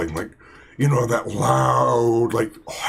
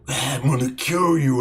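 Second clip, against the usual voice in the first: ordinary talk, nothing marked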